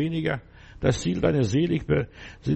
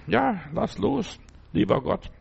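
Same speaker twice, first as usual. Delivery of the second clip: slow high plea; 5 semitones higher, slower than usual. Acted. Preacher cries out to God.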